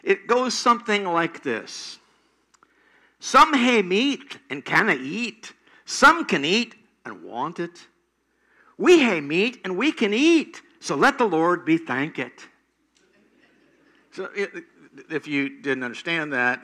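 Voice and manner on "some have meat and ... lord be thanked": Scottish accent. Shown as a demonstration. Preacher tries the Scottish grace in a Scottish accent. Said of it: "I attempt to do the Scottish accent".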